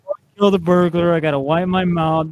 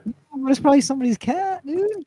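incredulous; 9 semitones higher, faster than usual. Real, not acted. Disbelief at taking cat.

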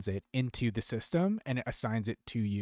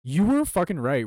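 loud, high conceding shout; excited admission; 6 semitones higher, 8 dB louder than usual. Real, not acted. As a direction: blurt it out, impressed. Conceding loudly.

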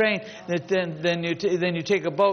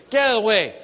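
loud, higher retold order; imitated impatient shout; 6 semitones higher, 7 dB louder, faster than usual. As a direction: shoo the kid away loudly, acting it out. Retold person shooing a little kid.

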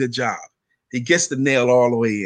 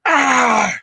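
sudden high, loud frustrated shout; angry kid's yell; 10 semitones higher, 4 dB louder than usual. Retold or imitated. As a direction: blurt it out like a furious ten-year-old. Angry boy yells in story.

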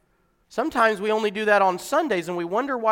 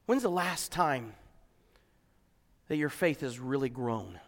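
softer, low, probing questions; hushed questioning; 5 semitones lower, 9 dB quieter than usual. Acted. Preacher quietly asks the congregation about their faith, hushed. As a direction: draw them in quietly.

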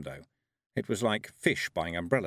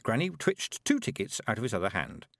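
ordinary delivery

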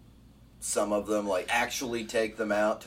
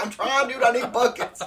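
very high, loud protest; frantic mock yell; 7 dB louder than usual. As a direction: shout back in a comic panic. Retold frantic reply to the yelling.

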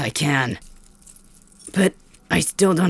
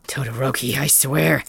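usual speaking voice

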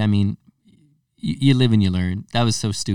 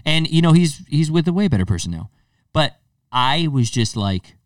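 ordinary delivery

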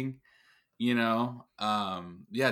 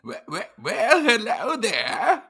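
put-on high, loud old invalid voice; drawn-out, hammed-up elderly man; Barry; 9 semitones higher, 6 dB louder, slower than usual. Barry makes his entrance on the podcast, silly old-man voice. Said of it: "just an old elderly infirm man named Barry".

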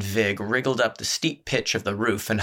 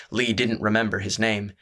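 plain reading voice

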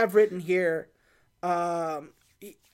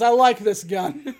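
louder line broken by laughter; laughing; 7 dB louder than usual. Retold or imitated. Kay telling Jay about his gun, laughing. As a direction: laugh your way through it.

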